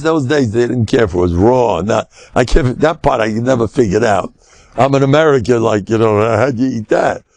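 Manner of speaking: normal talking voice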